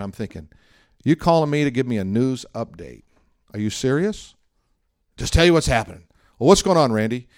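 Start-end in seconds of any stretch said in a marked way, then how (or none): 1.0-6.0 retold exasperated disbelief, pitched up; aggravated. Pastor retelling his annoyance at a friend's news update, aggravated. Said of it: "I'm aggravated". 6.4-7.3 higher, louder exasperated question; mock impatience with the caller; aggravated. Pastor prompting his rambling friend on the phone, aggravated. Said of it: "I'm aggravated".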